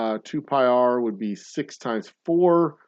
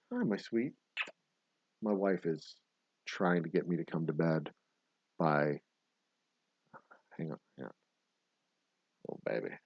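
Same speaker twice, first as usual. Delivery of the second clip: much softer, low murmur to wife at bedtime; real hushed aside, softer and lower; 5 semitones lower, 13 dB quieter than usual. Real, not acted. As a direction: keep it down so only she hears. Wife arrives, talks to her softly.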